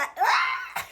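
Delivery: a sudden high burst of laughter. Real, not acted. Laughing at her own joke while telling the story.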